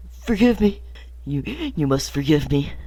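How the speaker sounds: acted choking up, halting with throat-clearing; hammed-up choked-up confession. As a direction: play it overcome, stumbling between broken phrases. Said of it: "I'm choked up".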